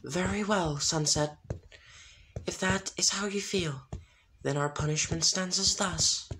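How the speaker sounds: judge her like an otherworldly spirit; spirits of harmony. A narrator's reading. Spirits pass sentence.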